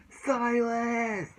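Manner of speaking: high, drawn-out call of Silas; mock shouted name. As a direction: yell his name across the stadium.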